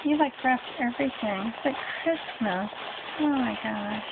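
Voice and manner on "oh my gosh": ordinary talk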